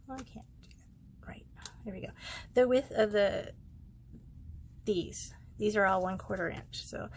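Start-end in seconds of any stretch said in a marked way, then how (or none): 0.1-2.1 whispering. Real, not acted. Muttering to herself, aside.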